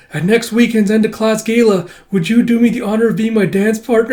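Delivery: high, eager little gentleman; boy asking for a dance. Acted.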